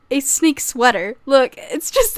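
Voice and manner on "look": usual speaking voice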